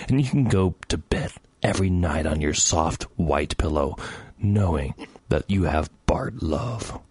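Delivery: low, slow sweet talk; mock love message, slow and low; mock-tender. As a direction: croon it intimately, hammed up, sinking toward a whisper. Mock-tender address to the listeners.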